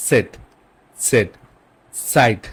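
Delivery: "সেট" and "সাইট" opened with a light ts; German Z as TS. Shown as a demonstration. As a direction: model a faint T before "সেট" and "সাইট". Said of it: "তটা একটু সামনে দিয়ে আসবে". ("সেট", "সাইট"-এর সামনে হালকা ত)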